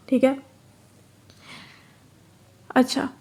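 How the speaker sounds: ordinary delivery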